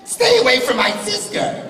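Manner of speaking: very high, laughing squeaky delivery; mock anime line. Joking voice for a silly anime, laughing. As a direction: squeak it like a hammed-up anime hero.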